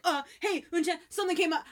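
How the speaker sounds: put-on man ditching girl for another; hasty excuse. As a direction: blurt the excuse and bail. Hurried excuse.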